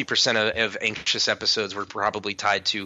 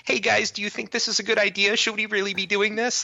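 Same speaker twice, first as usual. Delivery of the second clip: put-on much higher, timid worried kid voice; pitched-up imitation of an anxious good kid; cautious goody-goody kid; 11 semitones higher than usual. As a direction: play the nervous rule-follower asking the group. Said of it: "I was always the kid in the group that was like".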